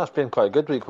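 usual speaking voice

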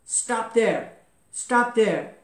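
loud, forceful order; shouted command. A narrator's reading. Enraged sage orders falling Trishanku to halt, shouting. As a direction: call it out to halt someone falling. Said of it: "he shouted to Trishanku".

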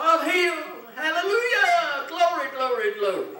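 very high, very loud ecstatic shouting; full-throated preacher's shout of praise; hollering praise. Retold or imitated. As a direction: shout it to the rafters in joy. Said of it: "They holler".

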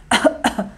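tossida de exemplo; dê uma tossida para ilustrar; tosse demonstrada. Shown as a demonstration. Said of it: "É tosse". (tosse fingida de demonstração)

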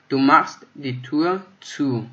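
slow, clear German sentence; slow model reading. Shown as a demonstration. Teacher to learners, slow and clear example sentence. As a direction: say it slowly so learners catch it. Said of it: "teacher reads example sentence".

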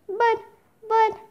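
halting, broken-off stammer. Acted. Jack caught by the bird seller, stammering. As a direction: stumble softly on the word, with a gap. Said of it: "stammered Jack".

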